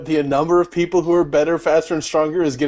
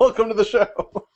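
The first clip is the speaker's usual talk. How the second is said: put-on grand old ballroom announcer; 7 semitones higher than usual. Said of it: "special guest, old-timey announcers guy".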